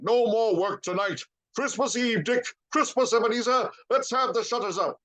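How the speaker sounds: high, merry cries; a cheerful master calling a holiday; jovial Fezziwig. A narrator's reading. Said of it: "cried old Fezziwig".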